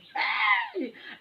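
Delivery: high-pitched burst of laughter. Real, not acted. Recalling the threat to viewers, high laugh. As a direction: break into a high shriek of laughter. Laughing at her own story.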